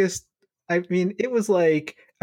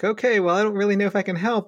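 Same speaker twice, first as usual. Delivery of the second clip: higher, quick, hesitant reply; playing along; 7 semitones higher, faster than usual. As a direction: humour a strange caller, hurried and higher. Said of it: "I would just go along with it".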